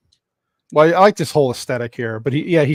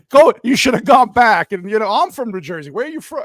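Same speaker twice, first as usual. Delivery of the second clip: mock Jersey attitude, higher and louder; East Coast attitude; 6 semitones higher, 4 dB louder than usual. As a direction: sass it like a Jersey local. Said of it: "you're like".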